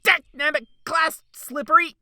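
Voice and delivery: nonsense outburst. Acted. Desperate nonsense shouted.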